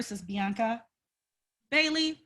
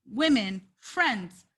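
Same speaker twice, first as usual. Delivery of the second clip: ordinary talk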